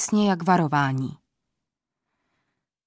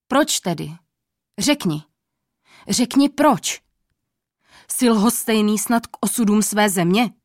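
king demanding an explanation, angry. A narrator's reading. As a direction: press the old man angrily for an answer. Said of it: "A hlas měl hněvivý".

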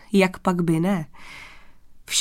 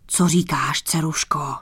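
lightly voiced fatherly king, lower; a hint of a father addressing his daughter, lower pitch; otec král; 3 semitones lower than usual. A narrator's reading. Otec král oslovuje dceru. (mírně hlubší hlas otce krále)

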